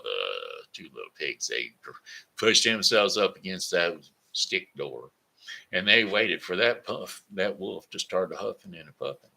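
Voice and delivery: usual speaking voice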